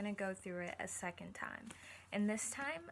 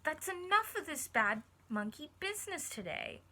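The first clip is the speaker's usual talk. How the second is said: loud scolding of a naughty monkey; storyteller shouting at a bad monkey; scolding cry; 6 semitones higher, 5 dB louder than usual. A narrator's reading. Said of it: "She cried".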